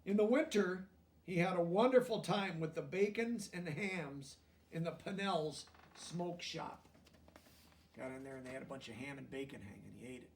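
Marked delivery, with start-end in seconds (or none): 0.1-6.9 lively storybook reading aloud; reading to children. A narrator's reading. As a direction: read it out to the class, bright and clear.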